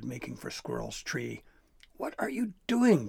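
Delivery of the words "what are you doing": high, louder scolding call; shouting. A narrator's reading. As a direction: shout in dismay at your mate. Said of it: "Gristle shouted at her mate".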